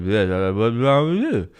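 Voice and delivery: slow, loud echo of the friend; mimicking the friend's alarm; mock friend voice. Quoting friend, slowed.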